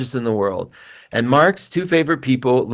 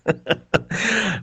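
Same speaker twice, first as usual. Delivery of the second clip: embarrassed laugh; 7 semitones higher, 5 dB quieter than usual. Real, not acted. Laughing at his own story.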